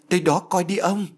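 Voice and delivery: anxious urging. A narrator's reading. Wife sends husband toward the groaning, anxious urging. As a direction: urge him on, voice lifted.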